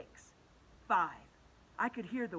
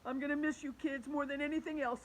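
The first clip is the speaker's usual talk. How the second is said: higher-pitched, mildly tearful farewell; 7 semitones higher than usual. A narrator's reading. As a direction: say goodbye with a touch of tears. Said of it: "tears in his eyes".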